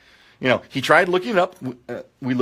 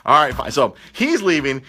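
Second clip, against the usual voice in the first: usual speaking voice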